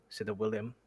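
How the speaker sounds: ordinary delivery, nothing marked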